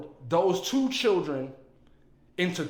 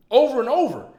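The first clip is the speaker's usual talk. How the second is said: high, loud anguished; 8 semitones higher, 10 dB louder than usual. Real, not acted. Emotional outburst.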